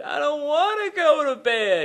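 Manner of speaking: put-on loud, high, drawn-out child's wail; hammed-up tearful protest, slow and loud; mock bawling. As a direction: imitate a kid bawling at bedtime. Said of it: "he starts bawling these tears again".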